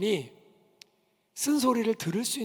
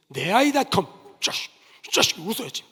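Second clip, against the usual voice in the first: a louder, rough mock growl of "이 자식"; gruff mock scolding; 7 dB louder than usual. Photographer jokingly threatening a child, mock gruff. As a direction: play the photographer barking at a kid, half joking.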